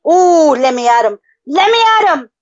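high, loud let me at him; fired-up shout. Real, not acted. Begging to fight Luke, fired up. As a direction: strain against the rope. Eager to mud wrestle.

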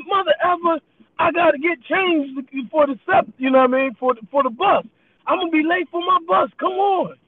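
high, pleading complaint; frustrated customer. Retold or imitated. Customer complaining he'll miss his bus, high and exasperated. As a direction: beg him to hurry, exasperated.

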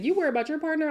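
ordinary delivery

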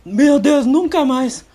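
high, loud shaken vow; shaken dad. Retold or imitated. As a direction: imitate a frightened dad swearing off flying. Dad vowing never again.

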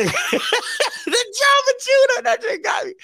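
high-pitched laughter through words; laughing. Real, not acted. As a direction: crack up while saying it. Laughing fit while quoting.

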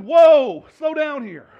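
loud, high whoa to a horse; reining in a horse. Acted. Isaiah reining himself in like a horse, loud high whoa. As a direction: yank the reins and shout whoa. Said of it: "pulled himself back and said".

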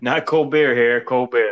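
slow loud ballpark vendor call; stadium vendor hawking. Pretend. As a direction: holler it across the stands, slowly.

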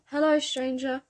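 lifted, cheery gatekeeper's hello; cheerful greeting. A narrator's reading. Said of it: "the man's cheerful tone".

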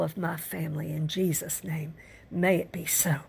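hushed, breathy prayer whisper. Real, not acted. Pastor closing his prayer in a whisper. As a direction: breathe the blessing softly.

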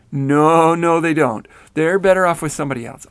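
loud, fast desperate protest; frantic refusal. A narrator's reading. Rennell insisting to Grandma she won't return, loud and fast. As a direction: push back hard and fast.

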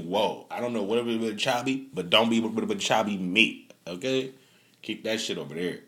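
ordinary delivery

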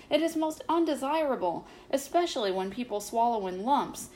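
ordinary delivery

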